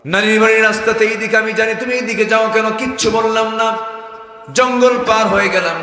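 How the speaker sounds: loud, high chanted narration; full-force preaching. Acted. Daughter questioning the road to grandmother's, full-force preaching.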